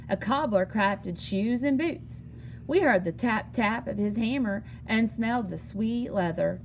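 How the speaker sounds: ordinary delivery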